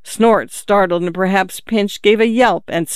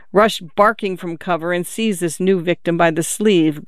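ordinary delivery

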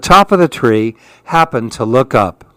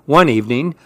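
plain reading voice

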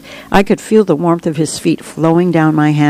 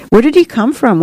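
put-on higher-pitched little boy's question; curious boy; 7 semitones higher than usual. A narrator's reading. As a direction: ask it like a curious child, voice lifted. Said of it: "one boy asked".

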